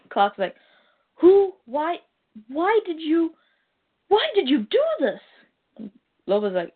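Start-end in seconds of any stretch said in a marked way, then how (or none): 1.1-5.2 high, halting, stammered questions; distraught disbelief. Acted. Clark confronting Lobo over the destruction, acted distraught disbelief. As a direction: demand a reason, struggling for words.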